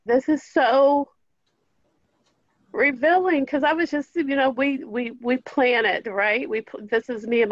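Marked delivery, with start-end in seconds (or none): 0.0-5.1 teary-eyed. Real, not acted. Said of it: "sorry, I'm getting teary-eyed".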